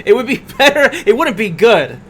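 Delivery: laughing loud and bright. Real, not acted. Laughing emphatic reply.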